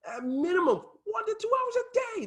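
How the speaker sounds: very high-pitched, light aside; sudden jump into a high voice; playful minimizing. Shown as a demonstration. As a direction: squeak it up high as if it's nothing. Mock-minimizing the time demanded.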